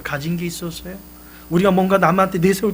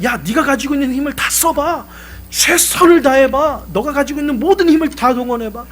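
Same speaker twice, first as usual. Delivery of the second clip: higher and louder, pushing him on; urging father; 9 semitones higher, 7 dB louder than usual. Retold or imitated. Father urging his son at the rock, loud and pressing. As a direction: cheer him on to give everything.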